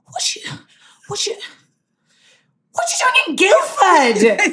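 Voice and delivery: gasp, then ask with grave disbelief; imitated winded Brit, rising to an incredulous peak; ominous British fan. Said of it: "said it like she knew something".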